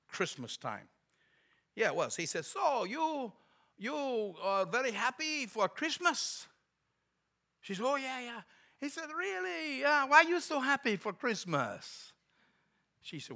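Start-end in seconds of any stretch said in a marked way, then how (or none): 2.4-12.1 high-pitched foreigner's broken English; slow, drawn-out Eastern European accent, puzzled questions. Retold or imitated. Visiting evangelist quizzing hotel clerk about Christmas, broken English accent. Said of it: "he spoke real broken English".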